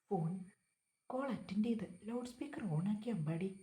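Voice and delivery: ordinary delivery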